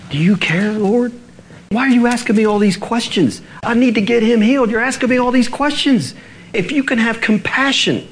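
high, impassioned desperate plea; crying out. Acted. Father demanding healing for his boy, desperate high cry. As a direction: cry out urgently, pitched far up. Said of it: "the man cried out".

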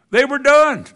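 loud, high punch line; emphatic shout. Real, not acted. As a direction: burst out with it. Climax after prophets ran out.

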